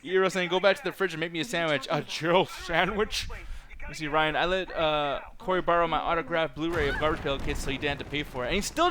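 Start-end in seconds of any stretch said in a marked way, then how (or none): none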